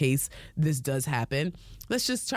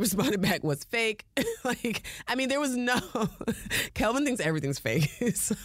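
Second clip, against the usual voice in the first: real giggles between higher-pitched phrases; laughing; 6 semitones higher than usual. Real, not acted. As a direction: tell it while cracking up. Laughing over husband's one-word reply.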